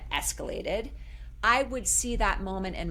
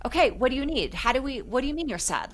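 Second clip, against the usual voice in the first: imitating a mildly frantic, higher-pitched mom; desperate to fix; 6 semitones higher than usual. Mother anxiously pressing her sad daughter, mock frantic. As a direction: rattle off the questions, anxious to fix it. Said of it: "this desperation to fix her sadness".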